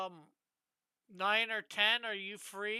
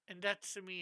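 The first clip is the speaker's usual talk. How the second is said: ordinary delivery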